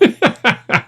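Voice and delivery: real laughter, high and breathless; cracking up at the clip; high helpless laughter. Real, not acted. Reacting to a woman tripping in the clip, real high laughter.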